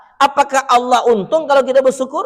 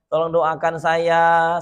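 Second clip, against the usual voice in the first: a weeping stone begging for prayer, low and soft; a lightly voiced sad stone; 5 semitones lower, 3 dB quieter than usual. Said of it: "Kata batu".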